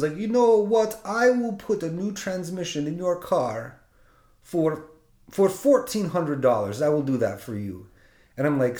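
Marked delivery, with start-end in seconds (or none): 0.2-7.9 put-on a light Russian accent, pitched higher at first; imitating the Russian mechanic making his offer; Russian tow truck guy. Mechanic offers a cheap transmission fix, light Russian accent. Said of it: "and he's Russian".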